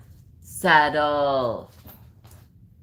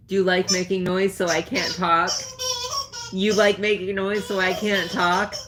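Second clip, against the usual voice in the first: raised sing-song voice teasing the dog; 8 semitones higher than usual. Real, not acted. Owner teasing her squeaking dog in baby talk. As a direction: coo at the dog playfully.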